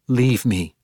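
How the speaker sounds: curt, firm command; firm order. A narrator's reading. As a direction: order her out, firmly. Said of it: "she ordered Ivanel".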